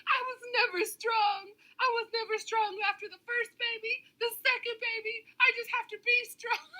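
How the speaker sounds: high, whiny fake-weepy voice; a mock tearful mother, pitched high and whining; mocking crying impression. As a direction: mimic her tearful live, hamming it up. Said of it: "What was she saying in that live".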